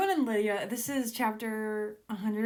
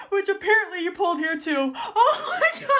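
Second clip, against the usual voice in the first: very high, loud laughing squeal; overexcited; 12 semitones higher, 10 dB louder than usual. Real, not acted. Reader overwhelmed by an exciting scene, squealing. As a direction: lose it with excitement partway through reading. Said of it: "This is too exciting".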